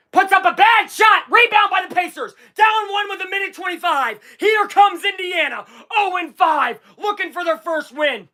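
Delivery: high, urgent live call; excited play-by-play. Real, not acted. Streamer calling a winless team's chance, excited. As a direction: call the break with rising hope.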